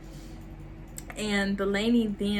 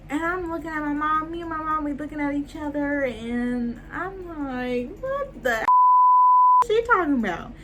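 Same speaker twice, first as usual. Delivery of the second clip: mock disbelief; 8 semitones higher, 3 dB louder than usual. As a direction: stretch it upward into exaggerated bafflement. Retelling shocked reaction with mom.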